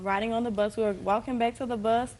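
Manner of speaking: usual speaking voice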